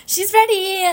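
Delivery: giggling through the words; high, laughing tease. Real, not acted. Laughing while teasing her friend.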